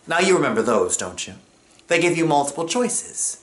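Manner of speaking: ordinary delivery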